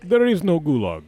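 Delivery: put-on heavy Russian accent, deadpan. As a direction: say it like a stern Russian. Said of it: "my turn to do the Russian accent".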